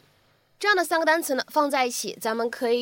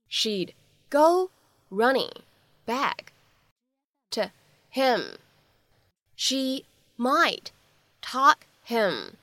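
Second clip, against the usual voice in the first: very slow, word by word with long pauses; slow, broken-up reading; much slower than usual. Shown as a demonstration. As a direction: spell out each chunk slowly, pausing between words. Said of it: "发音技巧需要来注意".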